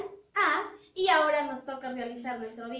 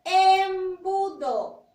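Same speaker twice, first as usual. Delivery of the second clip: Embudo stretched very slowly and loudly; slow, loud teacher model of Embudo; slow model word; 3 semitones higher, 7 dB louder, much slower than usual. Shown as a demonstration. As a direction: draw out the E of Embudo for the class. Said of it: "¿Inicia o no inicia con la vocal E?".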